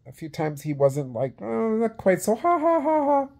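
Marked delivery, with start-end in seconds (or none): 1.4-3.3 high, put-on sarcastic laugh; mocking fake laugh. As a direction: sneer it out with a phony ha-ha. Mimics wrestler's fake laugh.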